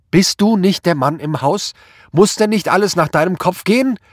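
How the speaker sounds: high, loud accusing screech. A narrator's reading. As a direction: screech the reproach at your husband. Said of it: "kreischte sie". (vorwurfsvoll kreischend)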